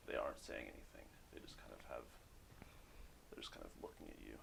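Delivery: near-whisper, low and very soft; hushed game master building suspense; hushed narration. Acted. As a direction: let the voice drop to an ominous hush. Game master narrates silent, menacing approach.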